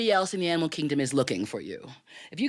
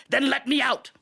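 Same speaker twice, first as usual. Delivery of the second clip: demanding tiger; 8 semitones higher, 4 dB louder than usual. Acted. Caged tiger answers the Brahmin.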